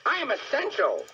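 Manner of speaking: high-pitched, self-important brake van; brake van character. Acted. Brake van insists it matters, high character voice.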